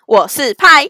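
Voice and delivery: loud burst into the mic; blasting the intro; too loud. Real, not acted. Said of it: "为什么有点爆音". (太大声)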